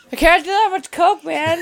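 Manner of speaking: high, loud dopey stoner. Acted. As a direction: whine as the wasted guy. Acting stoner Drew.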